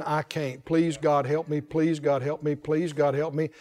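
low, soft, repetitive pleading; droning plea, worn down and quiet; mock tearful begging. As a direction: imitate a helpless believer begging, understated. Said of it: "just crying their eyes out, saying".

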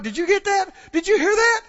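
high-pitched, eager exclamation; breaking upward into excited questions; excited churchgoer. Acted. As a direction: act out an excited believer. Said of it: "People will get excited and go".

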